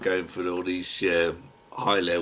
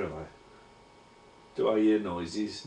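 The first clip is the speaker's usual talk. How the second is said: ordinary talk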